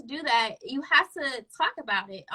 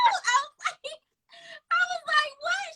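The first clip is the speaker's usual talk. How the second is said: laughing disbelief; 14 semitones higher than usual. Real, not acted.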